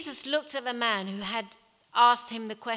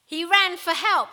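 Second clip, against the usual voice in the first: high, loud storyteller's shout; shouted guess; 9 semitones higher, 9 dB louder than usual. A narrator's reading. Someone in the crowd guesses the ending, shouted. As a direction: call it out eagerly to the front. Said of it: "shouted someone".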